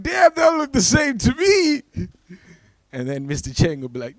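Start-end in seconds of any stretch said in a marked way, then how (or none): 0.0-1.8 high, hammed-up yell; mock dismayed shout. Comic realising he mistook another kid, shouting in mock dismay.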